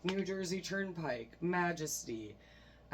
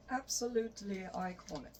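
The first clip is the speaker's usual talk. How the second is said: higher, playful put-on voice; 6 semitones higher than usual. Vlogger hyping a rock ballad to viewers, put-on voice. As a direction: slip into a silly higher voice for the verdict. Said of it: "I just talked in that voice".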